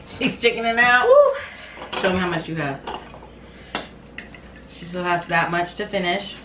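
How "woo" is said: excited cheer. Real, not acted. Cheering friend on.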